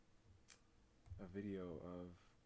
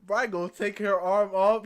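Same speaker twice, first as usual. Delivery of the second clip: loud disbelief; 7 semitones higher, 18 dB louder than usual. Real, not acted. Laughing disbelief to chat.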